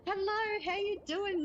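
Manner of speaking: high, loud chirpy greeting; bright mock stallholder hello; chipper stallholder. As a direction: chirp it like a cheery market seller. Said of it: "They always sound so chipper".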